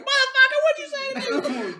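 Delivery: very high, very loud enraged shriek; furious scream. Acted. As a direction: scream it while beating him. Stephanie beating the nerd.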